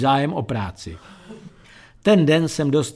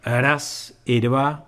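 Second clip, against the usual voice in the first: slow, thoughtful echo with a pause; pondering; much slower than usual. A narrator's reading. Narrator considers the barman's offer, pondering slowly. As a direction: mull it over slowly. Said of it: "Zamyslel jsem se".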